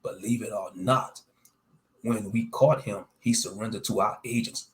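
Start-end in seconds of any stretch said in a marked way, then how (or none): none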